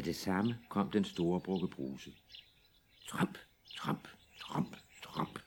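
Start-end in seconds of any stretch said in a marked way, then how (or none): 3.1-5.4 whispered tramp. Acted. Big goat's heavy steps.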